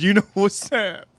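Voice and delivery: slowed, put-on knowing voice with a laugh; mock homeless guy, laughing. Imitating the homeless man's parting words with a laugh. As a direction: drawl the line like the guy did. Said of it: "He goes".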